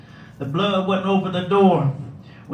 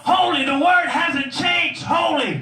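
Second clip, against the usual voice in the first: preaching at full force; 7 semitones higher than usual. Acted. Preacher lifting the congregation to the big blessings. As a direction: whoop it up like a revival preacher.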